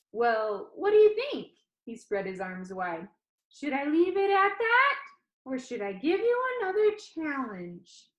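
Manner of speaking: perky showman pitching to his audience; cheerful Danny. Acted. Danny on video teasing the funeral crowd, bright and upbeat.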